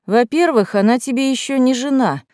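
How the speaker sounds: tedious, lecturing reminder; droning voice. A narrator's reading. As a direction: drone it like a bored teenager. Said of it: "занудным голосом напомнил нарцисс".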